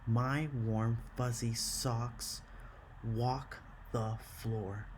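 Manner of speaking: slow, each syllable spaced out evenly; slow syllable count. Shown as a demonstration. Teacher slowly counts out syllables for her students. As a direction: count out every syllable for the class. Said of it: "Eight syllables in line four".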